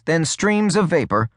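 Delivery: ordinary delivery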